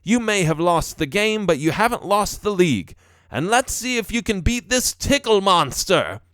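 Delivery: put-on rally the kid, building into a tease; encouraging, playful father. A narrator's reading. Father cheering son before tickling him, playful.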